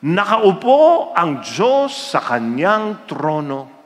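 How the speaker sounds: slow, deliberate verse reading; scripture reading. Retold or imitated. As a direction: read it out solemnly and slowly. Reader reads Bible verse.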